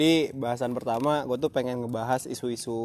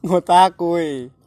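loud, slow and drawn out; slow emphatic; 5 semitones higher, 11 dB louder, much slower than usual. Shown as a demonstration. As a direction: spell out Ngotak kuy slowly and loudly. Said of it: "apa tadi?".

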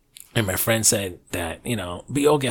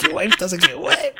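higher, sing-song mock of the guy; mocking taunt; 4 dB louder than usual. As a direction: taunt him the way people online did. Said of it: "People are like".

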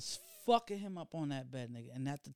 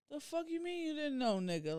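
much higher, incredulous comeback; indignant retort; 11 semitones higher than usual. Retold or imitated. Sister to nonchalant brother, high indignant retort. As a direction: throw it back at him, high and indignant.

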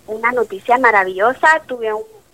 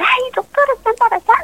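much higher, bright mock voice of the lab tech; imitating lab technician; 12 semitones higher than usual. Lab tech telling the doctor the news, high mock voice. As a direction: pitch up high to play the cheerful tech.

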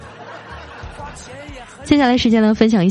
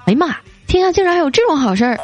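higher-pitched acted astonishment; retold amazement; 6 semitones higher, faster than usual. Farmer finds a free rabbit, mock-amazed. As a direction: play a farmer marvelling at his luck. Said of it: "这农夫很惊奇啊".

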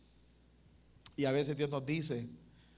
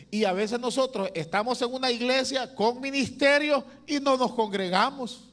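louder, higher, preaching with full force; 6 semitones higher, 6 dB louder than usual. Acted. Pastor urging his church to gather, full preaching force. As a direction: rise up and press it on the congregation.

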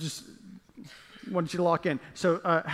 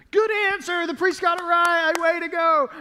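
much higher, hyped host voice; game show host; 10 semitones higher, 5 dB louder than usual. Pretend. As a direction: sell it like a TV host.

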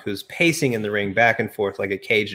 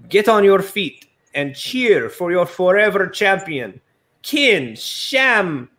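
Lana's hype speech; 5 semitones higher than usual. Acted. Lana hyping her champion.

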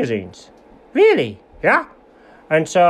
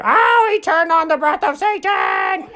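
horrified shout; 10 semitones higher than usual. Acted. Dramatic climax of story.

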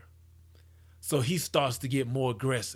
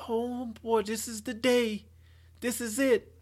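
put-on higher, giddy eager teen; eager boy; 8 semitones higher than usual. Young guy on a date, thrilled about his first time, excited. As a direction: sound like it's finally your big day. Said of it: "And he's just like".

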